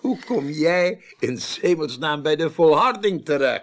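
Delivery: can't believe where he ended up; amazed question. Acted.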